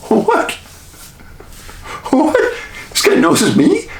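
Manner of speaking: high, laughing "what?" in disbelief; stunned disbelief. Retold or imitated. Host reliving being recognized, high laughing disbelief. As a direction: squeak out your shock, half laughing. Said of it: "I'm thinking".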